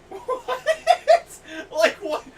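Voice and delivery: high, loud incredulous what; stunned, laughing disbelief; mock disbelief. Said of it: "I'm like".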